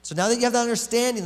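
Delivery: ordinary delivery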